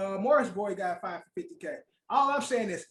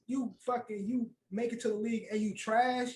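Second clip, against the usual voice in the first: normal talking voice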